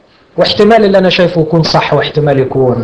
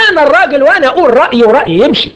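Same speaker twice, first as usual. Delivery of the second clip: زوج متعجرف؛ صوت أعلى وأسرع بتباهٍ مسرحي؛ قلها كرجل يفرض كلمته بغرور؛ تقليد ساخر لزوج مغرور يفرض رأيه; 8 semitones higher, faster than usual. Acted. Preacher voicing a stubborn husband.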